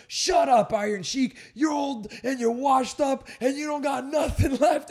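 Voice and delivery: high, loud staged heckle; heckler shout. Retold or imitated. Planted manager heckling the Iron Sheik, high loud shouting. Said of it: "gets up from the audience and goes".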